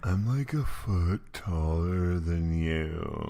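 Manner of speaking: slurred and very slow; drunk voice. Retold or imitated. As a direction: drag the words out drunkenly. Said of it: "You're making him a lot more drunk".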